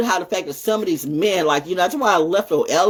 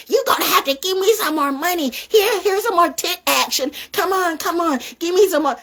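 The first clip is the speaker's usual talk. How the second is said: much higher, put-on coaxing voice; mock seductive plea; 10 semitones higher than usual. Mimicking a woman seducing a paying man, high coaxing voice. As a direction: play a flirt begging for more money.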